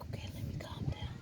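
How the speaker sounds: whisper, letting the breath go; whispered self-calming. Retold or imitated. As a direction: hush yourself down to calm. Retells herself calming down.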